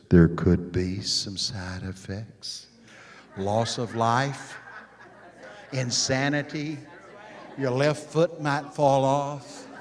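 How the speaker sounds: low, slow, far-off announcer voice reading side effects; mock drug ad disclaimer. Preacher mocks the pill commercial's disclaimer in a low, slow voice. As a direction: spoof a sleepy commercial voiceover, deadpan and slow. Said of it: "there's somebody talking".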